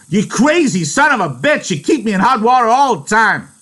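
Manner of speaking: very high, loud near-shout. Acted. George nearly shouting at Lenny, enraged. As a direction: bellow the insult. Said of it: "His voice rose nearly to a shout".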